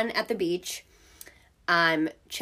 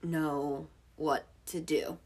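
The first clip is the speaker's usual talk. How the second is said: clueless firemen; 4 semitones lower, 8 dB quieter, slower than usual. Retold or imitated. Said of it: "they're like".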